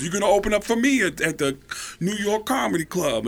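high-pitched comic mimicry; Tracy Morgan impression. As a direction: hand out the gig in a high put-on voice. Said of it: "my other favorite ... is Tracy Morgan".

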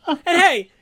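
high, loud burst; sudden excited lift; excited shout. Real, not acted. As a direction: pump it up.

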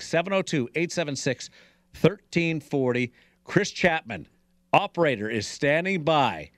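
high, punchy promo read; radio contest promo. Acted. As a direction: hype the call-in line.

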